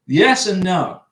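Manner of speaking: usual speaking voice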